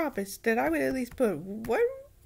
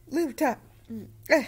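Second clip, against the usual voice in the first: yawning; 5 dB louder than usual. Real, not acted. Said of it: "Yawns will come out".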